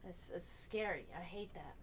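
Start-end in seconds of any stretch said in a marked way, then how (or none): none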